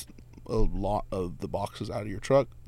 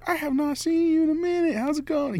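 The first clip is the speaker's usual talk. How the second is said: imitating an overjoyed shop owner, much higher; high, eager greeting of a friend he hasn't seen; excited shop owner. Shop owner greeting the driver he hasn't seen, imitated high and eager.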